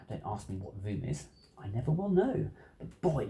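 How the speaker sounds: ordinary delivery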